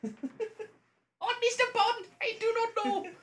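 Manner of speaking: very high, loud put-on voice; mock Bond-film captive. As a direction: draw it out in a squealing, hammed-up protest. Plays Santa answering as Bond villain.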